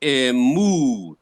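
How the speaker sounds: "Emu" with the pitch swooping up on a long "mu"; pitch going up. Shown as a demonstration. Teacher showing how to pronounce the accent, "Emu" swooping up. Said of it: "you go up".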